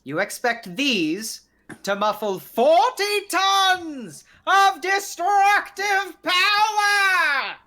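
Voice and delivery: booming, slow, high-pitched outrage; dragon voice. Acted. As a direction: bellow it like a huge beast. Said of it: "why are you yelling?".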